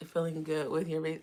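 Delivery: ordinary delivery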